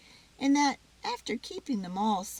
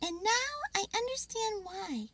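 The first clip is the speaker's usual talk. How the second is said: high squirrel, understanding; happy little realisation; 12 semitones higher than usual. Acted.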